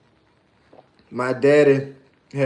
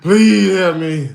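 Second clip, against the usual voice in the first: begging for help; 4 dB louder, slower than usual. Retold or imitated. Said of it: "I said".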